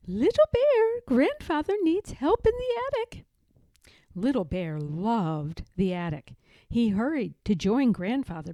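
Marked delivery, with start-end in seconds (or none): 0.1-3.2 high calling grandma voice; calling grandmother. A narrator's reading. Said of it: "Grandmother Bear called".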